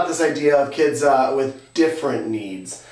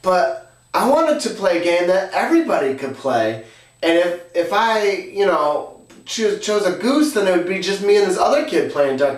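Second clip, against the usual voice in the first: higher-pitched imitation of a child; earnest kid; 4 semitones higher than usual. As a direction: explain it like a kid pleading to be understood. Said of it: "And he says".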